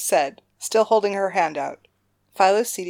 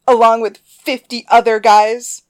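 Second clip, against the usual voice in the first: mocking little sister; 6 semitones higher, 12 dB louder than usual.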